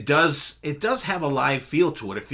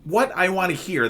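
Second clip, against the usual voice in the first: excited; 6 semitones higher than usual. Retold or imitated. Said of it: "I was like".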